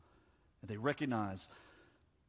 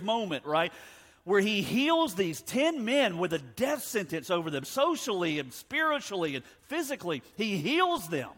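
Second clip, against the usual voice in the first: high, loud, driving sermon delivery; raised pulpit voice, pressing the point home; impassioned preaching; 7 semitones higher, 8 dB louder than usual. Real, not acted. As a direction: preach it at full force, hammering the key words. Preacher builds to full force.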